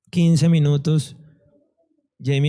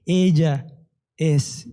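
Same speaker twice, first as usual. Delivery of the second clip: measured, word by word with pauses; deliberate, weighty pronouncement in short pieces; Holy Spirit speaking; much slower than usual. Retold or imitated. Holy Spirit answering his prayer, measured and deliberate. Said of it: "el Espíritu Santo me decía".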